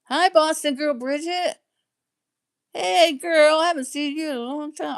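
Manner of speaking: light up and call out to her; excited greeting. Real, not acted. Greeting a chatter she hasn't seen.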